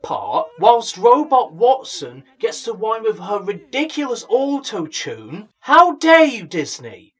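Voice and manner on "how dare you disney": loud, higher indignant cry at Disney; mock outrage. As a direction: hurl it like a betrayed fan. Outraged at Disney casting choice.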